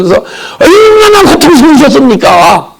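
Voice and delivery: choked cry that cracks on the repeated 고-; wailing cry. Retold or imitated. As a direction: sob it out, stammering through the tears. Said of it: "막 울부짖었어요".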